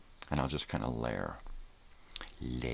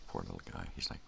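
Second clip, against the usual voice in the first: ordinary talk, nothing marked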